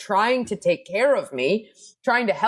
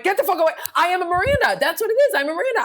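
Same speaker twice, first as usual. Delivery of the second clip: mock defiant shout; 11 semitones higher, much faster than usual. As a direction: shove everyone away with a proud mock yell. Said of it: "I'm like".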